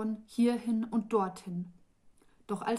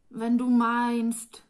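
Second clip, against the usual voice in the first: slow, stretched and louder; drawn out; 3 semitones higher, 7 dB louder, slower than usual. A narrator's reading. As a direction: drag the words out reluctantly. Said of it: "sagte Neljina gedehnt".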